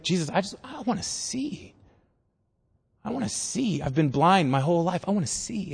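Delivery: quiet, vulnerable begging; soft acted plea. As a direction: plead softly, as the blind man. Blind man pleading with Jesus.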